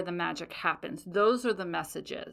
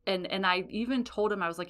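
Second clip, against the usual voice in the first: usual speaking voice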